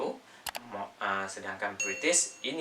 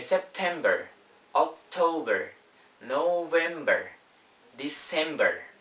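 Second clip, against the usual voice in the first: American accent, r sounded at the end of each month; higher, louder, drawn-out American "-ber"; American pronunciation; 4 semitones higher, 5 dB louder, slower than usual. Shown as a demonstration. Teacher contrasting American with British months, American accent. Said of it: "Itu American".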